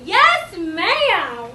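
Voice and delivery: high, loud, drawn-out answer; chorus of kids. Acted. Children answer the teacher's rules check, high loud drawn-out chorus. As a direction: sing it out like eager preschoolers. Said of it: "They go".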